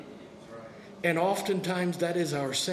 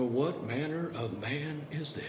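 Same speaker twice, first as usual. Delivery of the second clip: lower, softer, awestruck; amazed hush; 5 semitones lower, 6 dB quieter than usual. Retold or imitated. Believer after the storm, a quiet awestruck marvel. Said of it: "be amazed and go".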